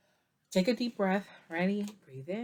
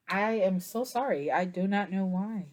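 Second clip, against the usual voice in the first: ordinary talk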